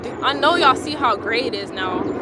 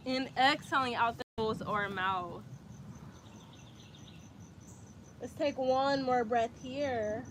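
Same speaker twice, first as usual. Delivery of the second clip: calm, even instructor voice; guided yoga; 11 dB quieter than usual. Acted. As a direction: coax them gently into frog pose. Leads frog pose sequence.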